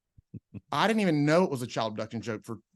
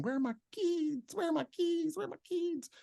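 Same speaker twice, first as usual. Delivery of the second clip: put-on a mother in a Zambian accent calling for her kids. Woman in a Zambian accent calling for her kids. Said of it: "But in her Zambian accent".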